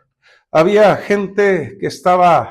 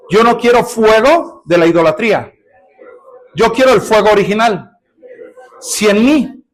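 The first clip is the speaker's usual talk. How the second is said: declaration prayer; 2 semitones higher than usual. Shown as a demonstration. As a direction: pray it out phrase by phrase with long pauses. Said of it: "A ver, declare".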